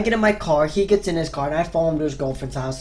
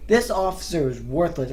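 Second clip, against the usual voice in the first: usual speaking voice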